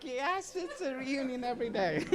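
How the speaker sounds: mock high voice, quoting her joke; imitating the chair. Resident quoting the chair's reunion joke, mock high voice. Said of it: "but she was very, like".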